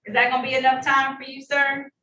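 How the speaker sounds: ordinary talk, nothing marked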